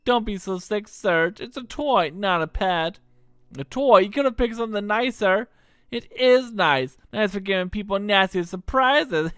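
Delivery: put-on cocky young troublemaker; Kid voice. Kid teasing Serge, lightly voiced brash girl. Kid's voice.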